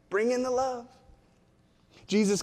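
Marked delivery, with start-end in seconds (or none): 0.1-0.9 put-on higher little-kid voice, lifting on "love"; four-year-old voice. Preacher voices a four-year-old's bid for connection, high child voice. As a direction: imitate a small child, pitched up. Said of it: "in a four-year-old voice".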